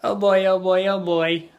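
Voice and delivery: acted fretting repetition; exclaiming. Shown as a demonstration. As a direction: repeat it with mounting feeling. Repeated example exclamation.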